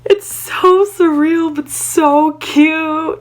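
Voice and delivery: high, slow, swooning gush; drawn-out high fangirl squeal. Real, not acted. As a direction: melt over how cute it is. Gushing about Kenji's line.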